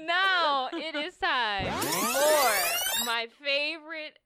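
big, loud drawn-out hype announcer; segment announcer. Real, not acted. Podcast host launching the favorite segment, loud and theatrical. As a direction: sing out the segment title over the music.

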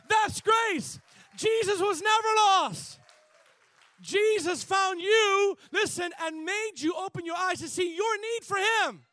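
loud, high, shouting preacher; full-force preaching. Acted.

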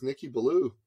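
ordinary talk, nothing marked

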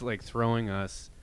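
ordinary talk

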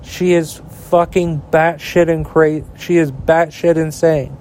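ordinary delivery

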